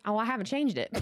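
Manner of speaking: normal talking voice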